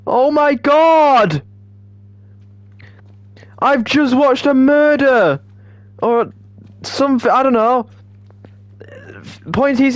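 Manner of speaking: high, hammed-up cries of horror; mock distraught. Streamer mourning a dead game character, theatrically distraught. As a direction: wail it like a melodramatic witness.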